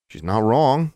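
mildly playful agreement; leavening the somberness. A narrator's reading. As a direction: lift it to lighten a somber moment. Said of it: "trying to leaven the somberness".

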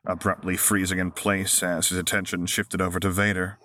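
ordinary delivery, nothing marked

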